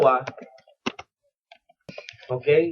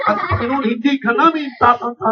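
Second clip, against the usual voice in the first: news anchor; 5 semitones higher than usual. Retold or imitated. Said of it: "parang si Mike Enriquez eh".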